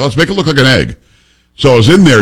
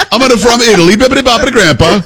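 high hammed-up Italian lady; sing-song mock Italian; mock Italian accent; 11 semitones higher than usual. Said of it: "Can you imagine she came up".

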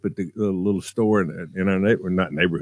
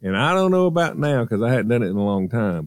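ordinary talk

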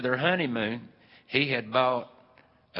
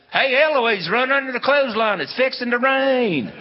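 high, loud call across the playground; retold playground yell; 13 semitones higher, 9 dB louder than usual. Kids on the playground yelling at Eloise before the rain, loud calling out. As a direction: shout the warning to run for cover. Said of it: "somebody'd yell".

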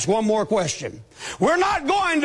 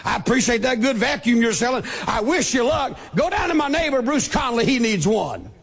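rushed, mock-grateful thanks; hammed-up thanks, said fast; mock gushing thanks; faster than usual. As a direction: rattle off fake gratitude quickly. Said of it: "follow him out to the car and say".

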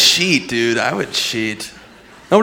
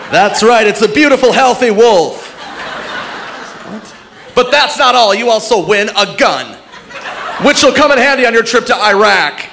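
game show host; 8 semitones higher, 3 dB louder than usual. Retold or imitated. Comic mocking host awarding a wolf. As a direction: announce it like a big prize. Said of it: "If they're like".

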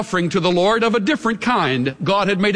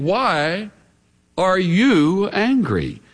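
pointed stress on YOU in a drawn-out question; 3 semitones higher, much slower than usual. Retold or imitated. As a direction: lean hard on the pronoun YOU. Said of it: "the emphasis is on the pronoun".

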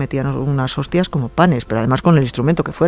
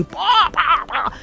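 high-pitched, playful banging noises; imitated hammer blows; 13 semitones higher, much slower than usual. Woman retells mother flattening the nail, imitated banging. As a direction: sound out each whack of the hammer.

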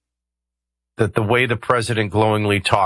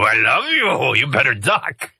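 mock Pepe Le Pew; 3 semitones higher than usual. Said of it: "looking like Pepe Le Pew and I go".

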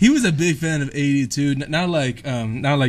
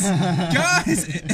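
higher-pitched call, drawn out with amusement; retold yelling; 4 semitones higher than usual. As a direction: re-enact an urgent call, half laughing. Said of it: "yelling like".

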